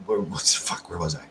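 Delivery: muttering under breath, unvoiced; a confused whispered aside. Real, not acted. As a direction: whisper it to yourself, lost. Lost train of thought mid-sentence.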